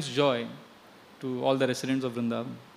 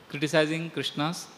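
ordinary talk

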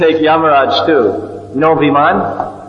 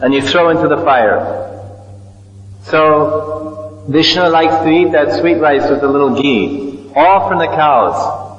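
ordinary delivery

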